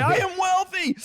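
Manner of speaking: I am wealthy squeaked very high; mock the affirmation in a high squeaky voice; exaggerated high affirmation. Shown as a demonstration. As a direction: overblown high-pitched chant of self-belief. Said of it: "Let me hear you affirm it".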